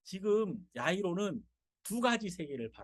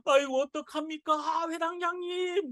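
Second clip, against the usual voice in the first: mock wailing mourners; 6 semitones higher than usual. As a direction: wail like grieving neighbours. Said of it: "막 울면서".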